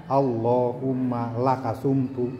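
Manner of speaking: a low, soft, mechanical prayer recital; mock rote reading. Shown as a demonstration. Teacher showing pupils rote prayer reading, low mumble.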